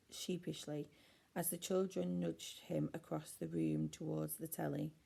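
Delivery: usual speaking voice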